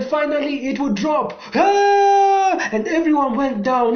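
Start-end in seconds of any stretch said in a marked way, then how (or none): 1.5-2.7 high loud scream; falling scream. A narrator's reading. Riders screaming over the waterfall edge. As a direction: shriek as the boat drops. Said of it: "Everyone was screaming".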